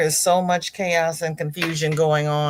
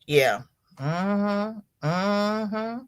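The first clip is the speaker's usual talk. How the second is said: ordinary talk, nothing marked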